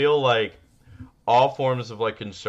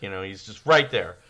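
ordinary delivery

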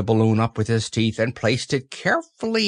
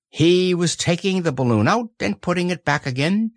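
ordinary delivery

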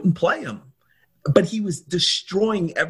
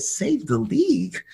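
higher-pitched disbelieving question; retold incredulity, pitched up; incredulous echo; 8 semitones higher than usual. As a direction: throw it back in disbelief. Teller's incredulous echo of boast.

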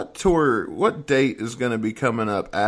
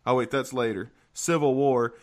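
ordinary talk

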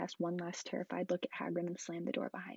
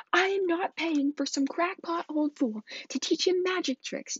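far higher, louder, slow indignant yell; 11 semitones higher, 8 dB louder, slower than usual. Acted. Uncle Vernon yelling at Hagrid, high and loud. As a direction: shriek it in a put-on blustering voice. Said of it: "yelled Uncle Vernon".

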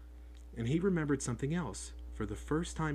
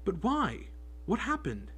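urgent; 8 semitones higher than usual. Acted. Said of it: "Harry asked urgently".

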